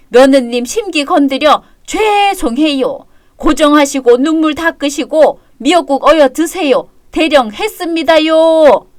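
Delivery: grovel with bitter sarcasm; exaggerated deference dripping with resentment; sarcastic apology. Mock apology to son and daughter-in-law.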